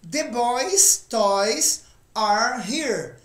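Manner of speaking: slow, careful English model sentence; teacher reads it slowly for the class; slow model reading. Shown as a demonstration. Teacher models the sentence slowly for students. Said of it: "Próximo exemplo".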